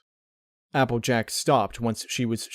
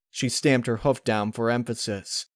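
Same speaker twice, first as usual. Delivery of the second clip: ordinary delivery, nothing marked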